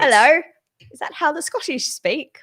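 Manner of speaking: a hammed-up, unconvincing Scottish brogue; bad Scottish accent, again on request. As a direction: fake Scottish accent that slips into East London Cockney. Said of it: "Do that again".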